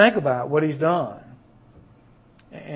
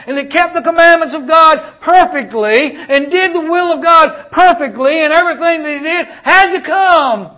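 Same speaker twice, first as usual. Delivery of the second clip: loud, high pulpit preaching; preaching at full force; 10 semitones higher, 11 dB louder than usual. Acted. Preacher proclaiming to the congregation, full-force preaching. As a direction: proclaim it to the rafters.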